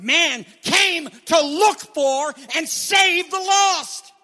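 high, loud and slow, the lost ringing out; preaching shout. Acted. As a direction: proclaim it to the back of the room. Preacher's emphatic climax.

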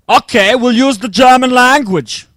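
playful put-on German accent. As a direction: say it like a German speaker, lightly hammed-up. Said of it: "I'm just doing an accent thing".

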